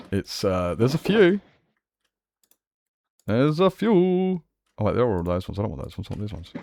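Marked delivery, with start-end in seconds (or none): 0.7-1.4 lilting. Real, not acted. First take, before drawing it out. 3.3-4.4 high, slow sing-song; lilting, slowed right down; playfully drawn out. Pretend.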